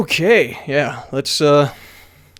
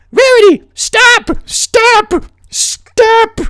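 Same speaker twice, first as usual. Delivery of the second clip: very high, louder stop stop stop; pleading shouts; 5 dB louder, much slower than usual. A narrator's reading. Twilight yells.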